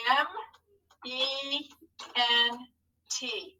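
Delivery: M... E... N... T, pausing after each letter; spelling for writing. Shown as a demonstration. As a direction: dictate M, E, N, T softly, one at a time. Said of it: "tell our hand what to write".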